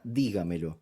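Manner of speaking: "dígamelo" stressed on dí, the rest light; stress on first syllable. Shown as a demonstration. Teacher exemplifying sobresdrújula, "dígamelo" stressed on dí. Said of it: "una palabra que se acentúa en di".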